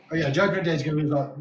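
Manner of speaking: usual speaking voice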